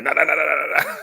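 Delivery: imitating a shooter waving it off; rapid nah nah brush-off; dismissive nah. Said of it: "that's like".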